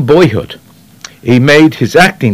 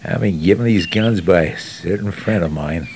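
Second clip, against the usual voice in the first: low, lazy American impersonation; Henry Fonda; 3 semitones lower, 7 dB quieter than usual. Shown as a demonstration. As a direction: throw the lines away, low and easy. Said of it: "And it was like this".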